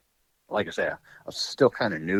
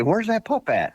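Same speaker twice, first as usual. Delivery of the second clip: pitched way up, a mock old hunter's question; imitating Joe; 4 dB louder than usual. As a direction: play the puzzled buddy, voice jumping high. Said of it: "he'd say".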